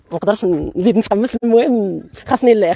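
usual speaking voice

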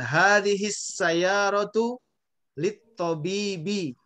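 slow "Hadihis sayyaratu lit tobibi", long vowels clearly held; drawn-out model of "Hadihis sayyaratu lit tobibi"; careful vowel lengths. Shown as a demonstration. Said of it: "panjang pendeknya perhatikan".

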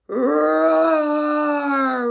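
long, loud rolling roar; troll roar. A narrator's reading. Troll rises at big goat, roaring. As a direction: let out a huge drawn-out roar. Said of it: "roared the troll".

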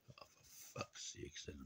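breathy whispered curse under the breath; fed up with Thatcher's Britain, whispering; exasperated whisper. Real, not acted. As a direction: mutter it out as air. Exasperated aside.

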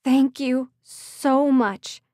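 slow, loud heartfelt thanks; overwhelmed gratitude. Acted. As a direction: draw out the thanks in relief. Her relief almost overwhelming her.

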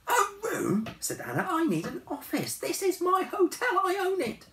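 put-on very high, indignant young woman; Anna's voice. A narrator's reading. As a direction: insist it's your hotel. Anna asserts ownership.

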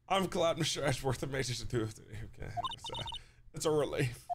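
lightly put-on cursive voice; Maya. Game character relieved for Mister Edgeworth, light cursive voice. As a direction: slur the vowels in cursive style, softly. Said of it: "introduce her cursive voice".

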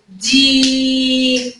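دي with the ee held long and level; sustained دييي; long yaa vowel. Shown as a demonstration. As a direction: stretch the vowel of دي on one note. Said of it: "المد بالياء بيحب قبليه الكسره".

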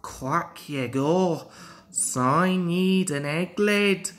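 lightly Scottish, teasing with mock quacks; a Scottish engine mocking his friend; sleepy Scottish tease, quacking in fun. Donald teases Duck, Scottish voice.

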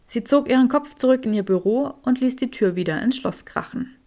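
ordinary delivery

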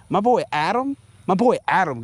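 normal talking voice

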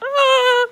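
high short "ah" scream; re-enacted scream. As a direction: scream briefly to show the fright. Re-enacts scream as bird flew out.

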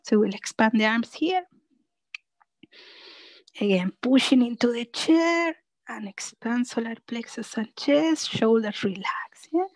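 usual speaking voice

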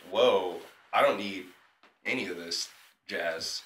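laid-back whoa. Retold or imitated. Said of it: "And I said it like that, too".

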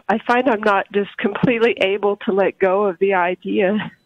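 ordinary delivery

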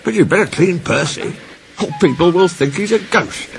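high, animated signalman voice; signalman's warning. A narrator's reading. Signalman advising crew to clean Percy, high and animated. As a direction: warn them in a high-pitched character voice.

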